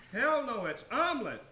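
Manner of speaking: high, emphatic outburst; loud contradiction. Acted. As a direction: burst in to contradict them. Character flatly contradicts the others.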